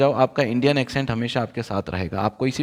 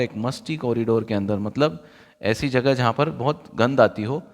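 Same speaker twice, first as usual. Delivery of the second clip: normal talking voice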